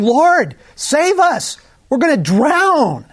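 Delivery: high, loud frightened plea; hammed-up panic from terrified disciples; panicked cry. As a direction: cry out like men about to drown.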